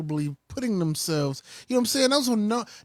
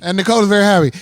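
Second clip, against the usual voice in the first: louder echo of the line with Nicole stressed; 10 dB louder, much faster than usual. Retold or imitated. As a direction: throw the same words back loud. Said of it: "echoing S1's line".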